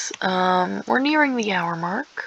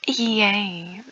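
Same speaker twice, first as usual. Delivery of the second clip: normal talking voice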